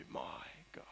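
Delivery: breathy whisper; whispered devotion. Acted. Preacher voicing Habakkuk's final whispered my God. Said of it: "he refers to God as".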